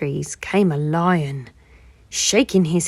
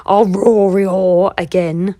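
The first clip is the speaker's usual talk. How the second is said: bear voice; 7 dB louder, much slower than usual. A narrator's reading. Bear offers to roar.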